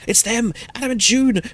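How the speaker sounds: high, loud excited cry. A narrator's reading. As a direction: cry out in startled recognition. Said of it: "Ronnie cries out".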